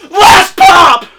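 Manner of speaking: loud, high triumphant shout; excited shout. Acted. Collector announcing the final pop, loud excited shout. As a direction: announce the last pop like a game show.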